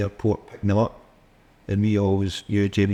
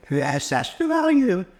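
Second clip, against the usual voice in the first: high-pitched mock angry boss on the phone; badly attempted Canadian, pitched way up; Fergus's accent; 11 semitones higher than usual. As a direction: imitate an indignant little boss, very high. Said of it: "I can't do Canadian after that. Wee Fergus's accent".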